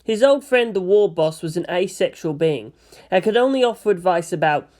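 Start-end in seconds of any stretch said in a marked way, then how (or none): none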